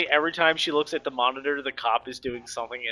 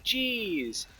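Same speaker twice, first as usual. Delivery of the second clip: higher, breathy shocked murmur; a quiet gasp of disbelief; soft dismayed jeez; 7 semitones higher, 5 dB quieter than usual. Retold or imitated. Reader reacts to the shooting with quiet dismay.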